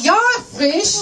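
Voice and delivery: high, loud eager reply; eager yes. Retold or imitated. Comic answering the juice seller, eager and high. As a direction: chirp it back overconfidently. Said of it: "And I'm like".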